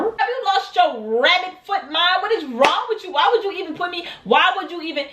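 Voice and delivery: high-pitched, incredulous telling-off; outraged scolding. Retold or imitated. Storyteller confronting the girl about her confession, outraged.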